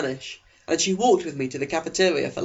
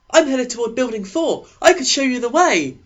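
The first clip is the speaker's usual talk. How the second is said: put-on higher, louder eager teen boy voice; eager Eric; 10 semitones higher, 6 dB louder than usual. Eric leaning over to the new girl, eager and high. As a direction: pitch him up high and keen, a chess-club type. Eric offers to guide her.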